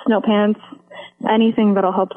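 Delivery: normal talking voice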